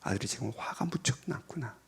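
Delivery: empathetic parent. Retold or imitated. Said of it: "공감하라".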